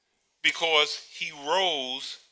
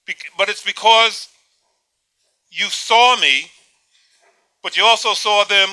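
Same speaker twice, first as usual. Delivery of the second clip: louder, calling out as if shut in a tomb; 7 dB louder than usual. Pretend. Preacher pretending to speak from the tomb, calling louder. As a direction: project it as though buried and calling out. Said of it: "speak loudly so I can hear you".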